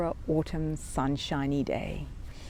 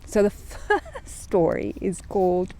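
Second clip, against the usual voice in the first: usual speaking voice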